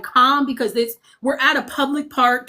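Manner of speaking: usual speaking voice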